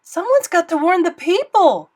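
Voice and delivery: loud, high urgent call; urgent cry. Acted. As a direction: cry it out with resolve. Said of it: "cried Bartholomew".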